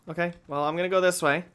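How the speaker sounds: normal talking voice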